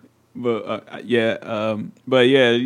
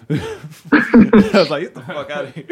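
laughing; 4 semitones higher, 4 dB louder than usual. Real, not acted.

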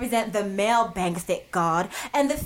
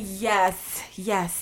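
usual speaking voice

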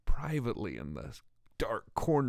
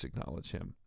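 whisper; 8 semitones lower, 10 dB quieter than usual. Shown as a demonstration. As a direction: drop to a breathy whisper on acknowledge him. Repeating the verse, dropping to whisper.

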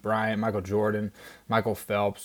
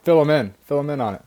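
excited urging; 5 semitones higher, 8 dB louder, faster than usual. Real, not acted. Eagerly urging co-host to explain.

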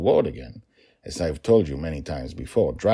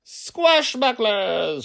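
put-on high, drawn-out child's battle cry; little girl shouting with all her might; long, high heroic yell; shouted; 5 dB louder, much slower than usual. A narrator's reading. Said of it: "she shouted".

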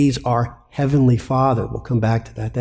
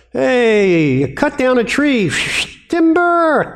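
higher and louder, calling out "timber"; mock woodcutter call; 12 semitones higher, 4 dB louder, slower than usual. Preacher retelling Isaiah's idol maker to the church, calling out. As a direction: holler it like felling a tree. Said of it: "and Isaiah goes".

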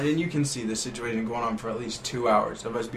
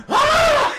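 a sudden high shriek, much louder; panicked shriek; 10 dB louder than usual. Retold or imitated. Retelling how the cornered friend yelped in panic. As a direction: yelp like someone cornered.

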